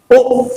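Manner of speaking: O said briefly, as the vowel in cook; short vowel. Shown as a demonstration. Teacher recapping the sound seen in these words, short O. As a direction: keep the O short and abrupt. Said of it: "short vowel".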